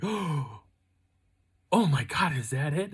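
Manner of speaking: astonished, voice lifted; amazed reaction. Retold or imitated. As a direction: act out her stunned discovery. Wife's amazed reaction, retold.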